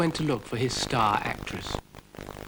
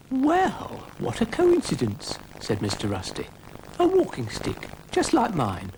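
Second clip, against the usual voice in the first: put-on high, quavery old man; lightly voiced old man delighted; old Mister Rusty; 7 semitones higher than usual. Mister Rusty greeting Florence, high old man voice.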